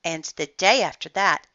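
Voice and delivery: ordinary delivery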